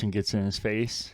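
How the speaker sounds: normal talking voice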